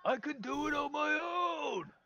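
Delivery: high, strained ogre yelling back; frustrated creature hollering at top pitch. Acted. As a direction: snap at your meddling brother, loud and drawn out. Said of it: "As he yells back".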